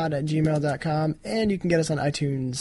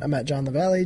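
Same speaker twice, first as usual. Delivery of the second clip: ordinary talk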